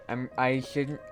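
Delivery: ordinary delivery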